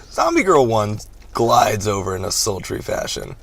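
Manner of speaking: usual speaking voice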